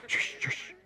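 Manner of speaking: breathless whisper; whispered hush. A narrator's reading. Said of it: "another voice whispered".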